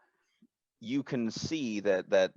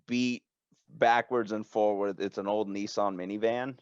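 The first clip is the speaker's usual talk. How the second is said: ordinary talk, nothing marked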